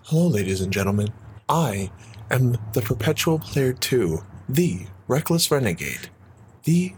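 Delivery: put-on ASMR-style intro voice. Shown as a demonstration. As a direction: savor each title, drawing it out with pauses. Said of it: "that, that voice".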